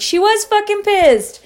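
high, drawn-out outburst; retold exasperated confirmation; emphatic exclamation. Emphatic retort to boyfriend, retold.

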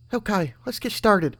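high, slow eager filly. A narrator's reading. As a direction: sound far too keen to start. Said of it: "a bit too excited".

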